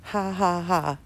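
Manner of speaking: ordinary talk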